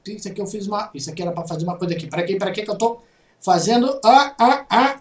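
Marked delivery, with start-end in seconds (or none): none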